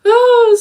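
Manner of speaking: a sudden high, loud groan of frustration; exasperated cry. Real, not acted. Venting to viewers about the Best Buy clerk, exasperated cry. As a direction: let out a sharp fed-up cry.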